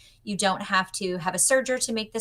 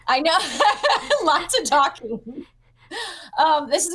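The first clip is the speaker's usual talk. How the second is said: laughing; 7 semitones higher, 5 dB louder, slower than usual. Real, not acted. Laughs at being told she talks.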